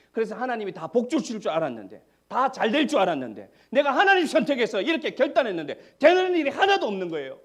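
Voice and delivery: the worshipper's disappointment growing into a loud, high outcry; frustrated complaint. Retold or imitated. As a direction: let the frustration climb into a shouted lament. Voicing believers' frustrated disappointment.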